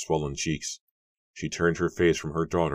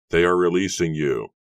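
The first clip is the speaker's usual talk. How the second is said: louder, raised voice carrying the news; calling out; 3 semitones higher, 7 dB louder than usual. A narrator's reading. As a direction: call it across to them. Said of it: "he called".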